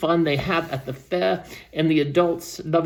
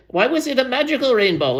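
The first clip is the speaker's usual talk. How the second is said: put-on high eager child voice; a small skunk asking, wide-eyed; young skunk voice; 7 semitones higher than usual. A narrator's reading. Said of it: "one of the young skunks said".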